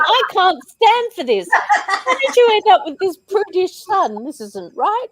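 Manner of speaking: mock outraged voice. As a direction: read the comment in a high scandalised squeak. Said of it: "Andrew is saying".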